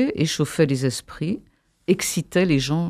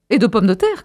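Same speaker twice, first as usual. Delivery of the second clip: raised, louder heckle from below; crying out; 6 semitones higher, 5 dB louder than usual. A narrator's reading. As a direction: call up from the crowd, half shouting. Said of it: "cria quelqu'un d'en bas". (interpellation criée, modérément)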